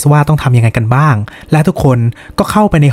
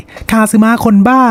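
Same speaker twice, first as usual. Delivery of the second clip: mock crying; 8 semitones higher, slower than usual. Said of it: "ได้แต่ร้องไห้".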